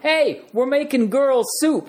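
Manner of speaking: high, slow, drawn-out actor impression; Christopher Walken. Actor greets guests from the tub, high slow impression. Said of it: "and he goes".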